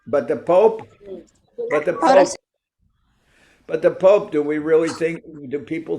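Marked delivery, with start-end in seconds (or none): 0.1-4.3 louder and higher with each repeat; emphatic build. Real, not acted. Lecturer pressing his point about the Pope, building emphasis. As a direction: hammer the point home, rising each time.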